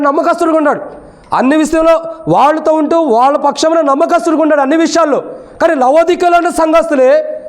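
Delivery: high-pitched, insistent sermon delivery; preaching. Real, not acted.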